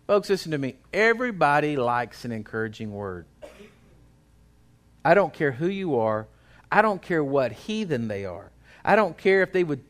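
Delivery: usual speaking voice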